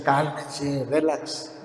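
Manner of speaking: hushed voice of calm reassurance; soothing reassurance. Retold or imitated. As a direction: settle the frightened disciples gently. Jesus calming the frightened disciples.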